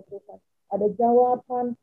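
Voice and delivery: ordinary talk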